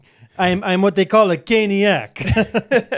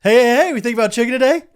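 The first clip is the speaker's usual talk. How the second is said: drive-thru worker greeting; 5 semitones higher, 5 dB louder, faster than usual. Retold or imitated. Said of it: "She drives up".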